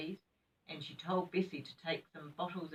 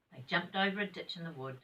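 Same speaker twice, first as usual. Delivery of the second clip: ordinary delivery, nothing marked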